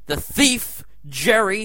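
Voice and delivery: ordinary talk, nothing marked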